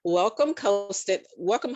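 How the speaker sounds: ordinary talk, nothing marked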